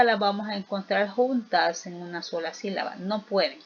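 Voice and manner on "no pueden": usual speaking voice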